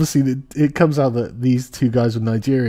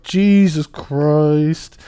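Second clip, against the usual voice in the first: very drawn-out, louder exclamation; mock shock; 3 semitones higher, 5 dB louder, much slower than usual. Fan reacting as comic goes after Me Too, mock shock. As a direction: stretch each word in amazed disbelief.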